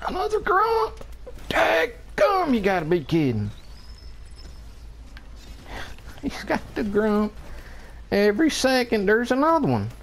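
high squealing outrage at the enemy; exasperated squeal. Real, not acted. Gamer to his teammates about the enemy Grump, high squealing outrage. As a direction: squeal it like the game is cheating you.

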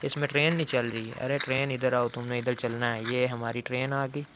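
usual speaking voice

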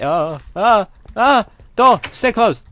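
panicked high yelps at a door; scared screaming. Real, not acted. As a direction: shriek in panic as the zombie comes.

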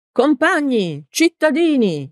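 high, ringing address to a crowd; balcony rallying cry; orator's shout. A narrator's reading. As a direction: call the crowd to attention, pitch well up. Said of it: "gridò Peppone".

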